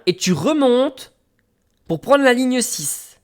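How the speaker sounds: high, slow mimicked instruction; lady giving directions. Station lady guiding traveller to line six, slow high imitation. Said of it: "Elle me dit".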